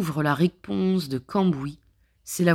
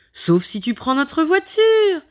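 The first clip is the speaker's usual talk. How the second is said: bright-eyed helpful friend, pitched up; higher eager woman voice; 10 semitones higher, 6 dB louder than usual. Acted. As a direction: offer it eagerly, high and loud. Marie Pompon offers car.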